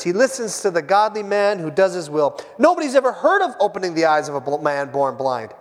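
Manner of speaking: ordinary talk, nothing marked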